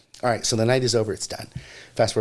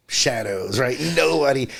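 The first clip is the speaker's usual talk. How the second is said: ordinary talk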